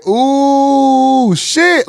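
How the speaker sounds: OOH drawn out high, then a quick high SHIT; long emphasized OOH. Shown as a demonstration. Host coaching the U sound, OOH stretched high. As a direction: hold OOH long before popping SHIT. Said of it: "you gotta put the emphasis on that U".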